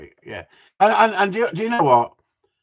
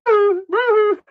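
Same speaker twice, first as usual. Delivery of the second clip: put-on high, plummy posh accent; 6 dB louder than usual. Mocking Harry's spelling in a posh accent. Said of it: "He's being posh".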